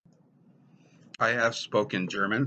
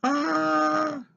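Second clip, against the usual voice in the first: high squeak; 4 dB louder than usual. Acted. Punchline exclamation.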